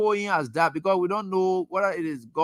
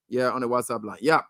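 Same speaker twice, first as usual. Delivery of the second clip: usual speaking voice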